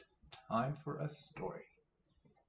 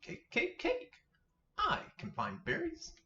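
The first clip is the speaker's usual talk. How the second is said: tiny squeaky bunny, much higher; little bunny voice. Acted. Minnie Rabbit to Mother Rabbit, squeaky high bunny voice. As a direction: chirp it like an eager baby rabbit. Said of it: "Minnie Rabbit says".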